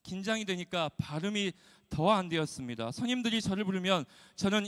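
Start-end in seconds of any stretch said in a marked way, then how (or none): none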